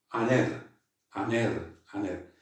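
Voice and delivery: "aner" spoken with a clean vowel start, no H; smooth breathing aner. Shown as a demonstration. Teacher repeats "aner" for the class. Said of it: "como una A, simplemente".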